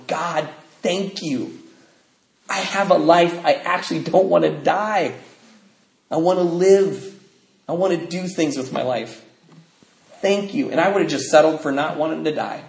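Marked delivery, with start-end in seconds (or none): 0.1-10.7 grateful tearful prayer. Retold or imitated. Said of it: "just going".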